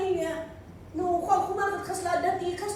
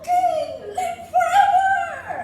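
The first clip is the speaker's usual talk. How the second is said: much higher and louder, slow call; echoing shout; 13 semitones higher, 10 dB louder, slower than usual. Acted. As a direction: shout it so it echoes off stone. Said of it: "sumagot si Daniel".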